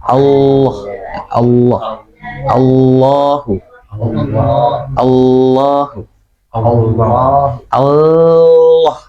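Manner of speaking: "Allah" and "Allahu" drawn out with heavy L; lam tebal. Shown as a demonstration. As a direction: hold the dark L with a wide tongue in "Allah". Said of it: "jadinya tebal". ("Allah", "Allahu" dengan lam tebal dan panjang)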